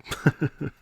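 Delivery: high, loud nervous laugh; an uneasy burst of laughter. Real, not acted. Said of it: "that was a nervous laugh".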